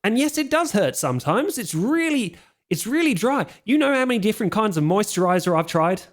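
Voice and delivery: high, fast, fussy mummy complaining about his skin; a vain mummy fretting over moisturizer, high and quick. Acted. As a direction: whine about dry skin in a high rush. GM voices mummy Bruce.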